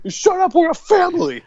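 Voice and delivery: very high squeaky snap; mock family squabble; high mock squeak. Voicing family.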